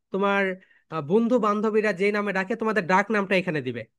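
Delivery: ordinary delivery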